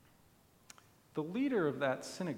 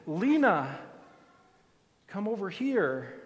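calling her name. Acted. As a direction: call her over kindly. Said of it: "calls her by name".

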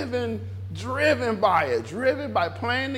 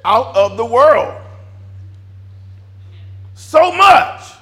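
emphatic preaching shout; 2 semitones higher, 10 dB louder, much slower than usual. Real, not acted. Preacher warning the congregation off the world, loud emphatic shout. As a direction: hammer it home at full volume. Preacher drives the admonition home.

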